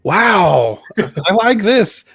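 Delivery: high and very slow "I like this"; delighted surprise. Retold or imitated. Fan on a sick day, slowly delighted by the movie. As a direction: savor the discovery, stretching it out. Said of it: "I was like".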